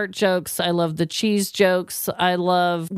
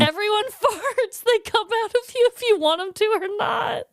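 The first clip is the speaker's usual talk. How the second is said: high, laughing, then near shouting; excited outburst; 12 semitones higher than usual. Real, not acted. Host to co-host, ranting that everyone farts, high and shouting. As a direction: blurt it out, rushing at the end.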